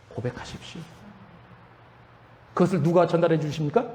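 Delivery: ordinary talk, nothing marked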